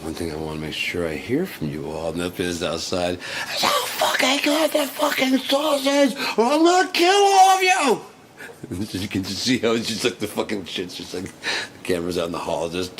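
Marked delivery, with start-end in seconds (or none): 3.6-8.0 high, loud screamed threat; hammed-up shrieking rage; mock screaming threat. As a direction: scream it at the whole room, much higher. Imitating a screaming teacher.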